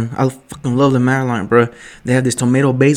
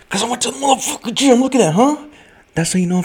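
high, showy boast; hammed-up bragging about the gym; mock bragging; 11 semitones higher than usual. Real, not acted. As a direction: show off, hamming it up.

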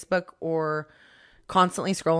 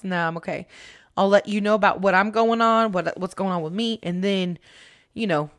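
ordinary talk, nothing marked

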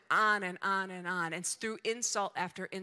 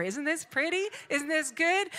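lilting questions, raised at the end; mock admiring; 7 semitones higher, 3 dB louder than usual. Preacher mimicking someone showing off shiny armor, mock admiring. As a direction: coo over it in pretend admiration.

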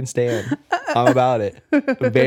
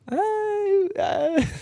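flustered high stammer. Retold or imitated. Flustered retold reply about [name].

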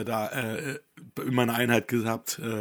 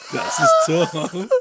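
high, slow laughing reveal; 8 dB louder, much slower than usual. Real, not acted. Presenting Tom to viewers, laughing. Showing Tom's photo laughing.

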